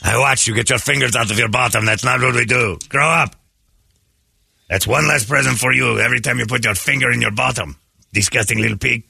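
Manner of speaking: scolding elf with a put-on French accent. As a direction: tell a child off in a mock French accent. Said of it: "French accent".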